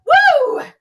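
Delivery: high, loud cheering woo; excited woo. Shown as a demonstration. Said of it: "we're so excited, we go".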